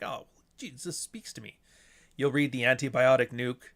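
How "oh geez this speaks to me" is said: whispery, much softer aside; under-the-breath acted revelation; hushed mock awe. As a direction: imitate a reader quietly touched. Acting out a moved reader.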